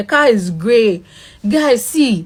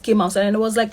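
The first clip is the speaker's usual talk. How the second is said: usual speaking voice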